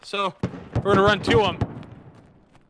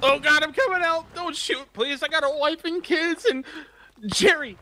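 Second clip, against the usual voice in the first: high-pitched plea for mercy; mock begging; 7 semitones higher than usual. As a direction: plead for your life, hammed up. Acted surrender plea in game.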